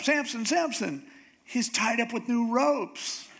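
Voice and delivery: high-pitched urgent wake-up cry; mock alarm. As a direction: sound the alarm to a sleeper, pitch jumped up. Delilah acts out waking Samson again.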